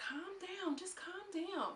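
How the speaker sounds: high, soft hush of calm down; soft high voicing of Jocelyn calming Barbie; high soothing voice. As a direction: hush her like a soothing friend.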